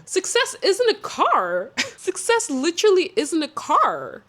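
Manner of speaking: exasperated, high-pitched objection; incredulous protest. Acted. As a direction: protest it as utterly absurd. Mock outrage at the claim.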